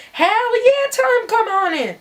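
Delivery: high-pitched, enthusiastic go-ahead; eager agreement. Retold or imitated. Z tells the storyteller to let them in, eagerly. Z's excited yes, retold.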